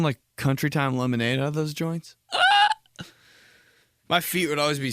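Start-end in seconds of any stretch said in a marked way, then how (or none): 2.3-2.7 excited squeal. Retold or imitated. Kid's burst of joy.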